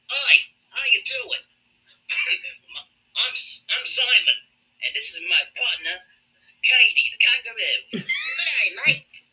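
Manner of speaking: high, shouty Australian host voice; hammed-up Aussie presenter; Australian accent. Australian presenters greeting America in a high, hammed-up Australian accent. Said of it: "they'll be like".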